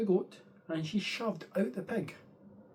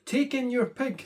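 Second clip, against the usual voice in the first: higher, louder confident advice; wise old man; 8 semitones higher, 4 dB louder than usual. Acted. Wise old man telling the lady to take her hen. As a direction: announce the plan brightly.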